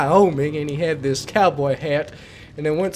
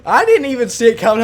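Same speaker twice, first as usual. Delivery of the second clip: ordinary talk, nothing marked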